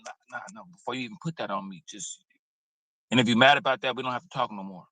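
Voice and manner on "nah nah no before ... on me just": soft, gentle no-thank-you; kindly refusing. Retold or imitated. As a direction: decline softly and kindly, much quieter. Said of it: "you just kindly going".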